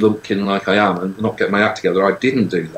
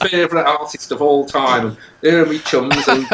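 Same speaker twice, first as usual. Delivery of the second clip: lightly put-on Geordie accent, pitched higher; hamming up a Geordie accent to charm his way in; 6 semitones higher than usual. To the woman at the sold-out door, a hammy Geordie plea. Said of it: "I put my best Geordie accent on".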